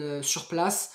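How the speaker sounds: usual speaking voice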